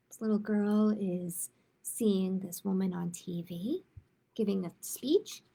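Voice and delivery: storytime read-aloud voice, gentle and clear; picture book read to kids; reading to children. Acted. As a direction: read it like storytime for little ones. Librarian reads picture book aloud.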